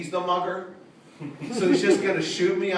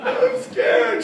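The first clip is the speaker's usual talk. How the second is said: high, laughing squeal; mock scared; 5 dB louder than usual. Comic pretends the crowd scares him, high squeal.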